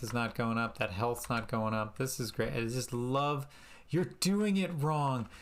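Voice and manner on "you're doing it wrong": higher, slowed mock scold; hammed-up voice of a snooty viewer; mock critic voice. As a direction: sneer like a nagging critic. Mocking an imagined critic.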